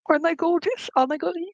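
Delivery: high, playful showing-off voice; mock-proud boast; proud spirit. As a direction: tease about the gleaming teeth. Spirit showing off his teeth.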